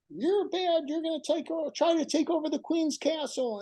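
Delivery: put-on high, playful villain voice of a small boy; child's villain voice. Boy voicing a villain in his bedtime game, high and playful. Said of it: "using a different voice".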